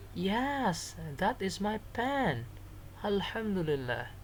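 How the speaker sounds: high-pitched character answer; acted glad recognition. Shown as a demonstration. Alip answering Samir about the lost pen, high acted relief. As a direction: claim it in a lifted, high voice.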